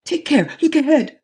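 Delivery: very high urgent cry; alarmed warning. A narrator's reading. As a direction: shout a frantic warning. Scared passenger warning.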